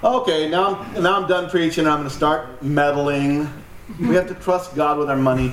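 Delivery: usual speaking voice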